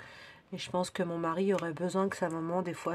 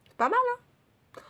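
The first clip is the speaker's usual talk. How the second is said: voix aiguë; 4 dB louder, slower than usual. Acted. Petite voix aiguë mignonne.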